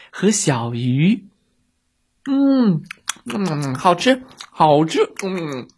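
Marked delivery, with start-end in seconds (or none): 2.3-5.7 high happy hum then yummy; delighted eating. Acted. Little monster loves the seaweed, delighted. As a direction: savour every bite. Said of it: "小妖怪高兴极了".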